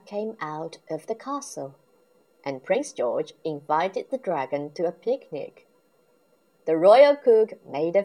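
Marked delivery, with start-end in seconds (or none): none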